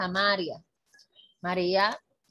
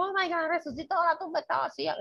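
much higher, stunned amazement; amazed; 9 semitones higher than usual. Retold or imitated. Apostles discovering the empty tomb, high and amazed. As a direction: gasp it out in disbelief.

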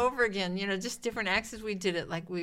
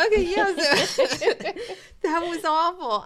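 laughing; 9 semitones higher, 10 dB louder than usual. Real, not acted. Still laughing.

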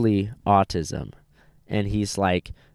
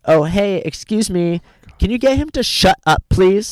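imitating her, higher, sugary-polite demand; hammed-up fake-polite complaint to the family; mock entitled woman; 7 semitones higher than usual. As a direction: ham up a rude lady asking nicely. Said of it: "She goes".

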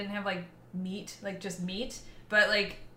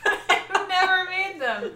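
laughing; 10 semitones higher, 6 dB louder, much slower than usual. Real, not acted. Breaks into laughter.

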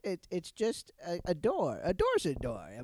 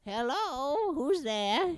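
high sing-song greeting; 6 semitones higher, slower than usual. Acted. Answering door in sing-song.

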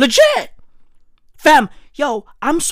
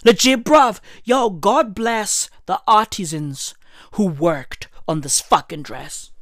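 normal talking voice

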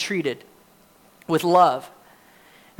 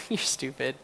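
soft, much quieter teasing jab; endearing sarcasm; 6 semitones lower, 13 dB quieter than usual. Retold or imitated. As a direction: tease him lovingly for missing keys. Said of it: "I see that as endearing and sarcastic".

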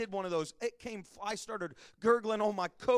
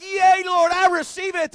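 higher and louder, joyful acceptance; a delighted yes to the Lord; thrilled churchgoer; 8 semitones higher, 10 dB louder than usual. Pretend. Preacher imagines Sister Tryon welcoming a baby prophecy, excitedly. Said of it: "she'll be like".

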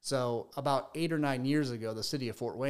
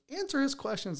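much higher-pitched mock scolding wife; imitated wife; 13 semitones higher than usual. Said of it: "his wife actually came out and said".

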